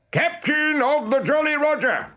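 Hook imitation. A narrator's reading. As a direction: announce yourself as the pirate captain. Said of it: "he answered".

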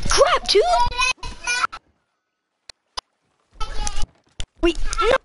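high-pitched alarm; panicked exclamation. Real, not acted. Player as his plan goes wrong, high panicked yelps.

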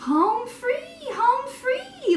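high, bright, gleeful cry; triumphant shout. Acted. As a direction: cheer like winning hide-and-seek. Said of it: "Little Hippo shouted".

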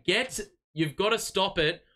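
ordinary delivery

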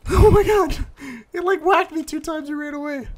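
high, loud, breathless disbelief; squealing through a laugh; shrill laughing outburst. Real, not acted. Player telling friend the enemy hit and ran, squealing.

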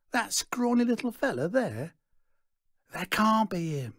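high-pitched, scoffing disbelief; squeaky, doubting heckle; incredulous onlookers. A narrator's reading. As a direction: pitch it way up, a crowd member who can't believe it. Crowd voices doubting Stanley.

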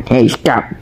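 usual speaking voice

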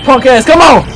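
loud, high taunting yell; trash-talk the monster loudly; taunting shout. Real, not acted. Taunting enemy during fight.

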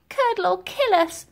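high-pitched worried orphan; lightly voiced scared child; fearful child's murmur. A narrator's reading. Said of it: "There were murmurs of".

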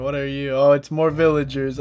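ordinary delivery

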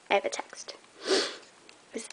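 hushed unvoiced aside; whispering. Real, not acted. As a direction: whisper it to yourself. Quiet aside while crafting.

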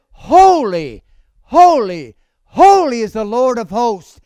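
loud, ringing cry of praise, each holy falling. Acted. Preacher voicing angels crying to one another, crying out. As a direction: proclaim it loudly like calling to another. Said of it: "And one cried to another".

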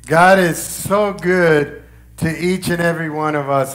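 ordinary delivery

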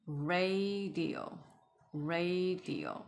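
"radio" stretched slowly into ray-dio; slow teacher's repetition of "radio"; drawn-out model. Shown as a demonstration. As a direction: hold the first syllable of "radio" long. Teacher models new word slowly.

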